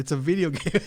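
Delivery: lightly hammed-up scoff; scoffing friend. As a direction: sneer it like the dismissive friend. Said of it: "he said".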